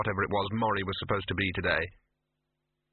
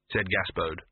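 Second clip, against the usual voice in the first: plain reading voice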